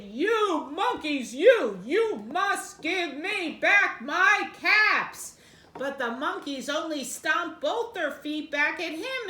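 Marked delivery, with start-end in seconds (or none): 0.0-5.4 louder, slow, stamping demand; storybook shout. Acted. Furious peddler shouting at the monkeys, slow and loud. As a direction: shout each word slowly at them. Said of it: "stomped both his feet and shouted".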